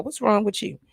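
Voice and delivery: ordinary delivery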